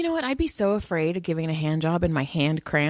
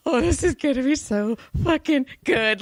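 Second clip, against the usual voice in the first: giddy; 7 semitones higher than usual. Retold or imitated. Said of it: "And I was like".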